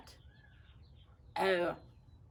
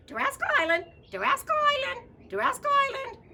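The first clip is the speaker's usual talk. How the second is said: squawking parrot; 11 dB louder, faster than usual. Acted. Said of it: "said the parrot".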